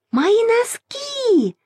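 very high, drawn-out wail of protest; crying out. A narrator's reading. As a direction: wail for your things, stretching the last vowel. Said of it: "закричала Маша". (протяжно кричит высоким голосом)

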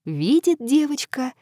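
plain reading voice

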